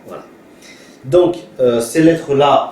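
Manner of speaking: ordinary talk, nothing marked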